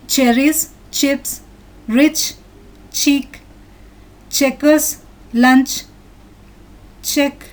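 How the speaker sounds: each word alone with a distinct "ch", as in "cherries". Shown as a demonstration. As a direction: read "cherries" to "catch" one by one, with pauses. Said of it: "C H syllables are pronounced as ch".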